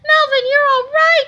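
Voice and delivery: high, loud relieved cry; mother cries out to her son, high and loud. A narrator's reading. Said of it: "cried Missus Sneedley".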